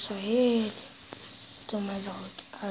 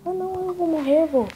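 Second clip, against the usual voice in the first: panicked gamer; 5 semitones higher, 4 dB louder than usual. Real, not acted. Gamer about to die.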